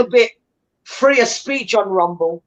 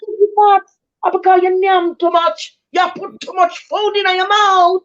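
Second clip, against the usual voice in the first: higher Jamaican patois storyteller voice, slow; Miss Lou; 8 semitones higher than usual. Acted. To her friend, scolding like Miss Lou in Jamaican patois. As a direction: Jamaican folk-auntie scolding, pitched up. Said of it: "You sound like Miss Louie".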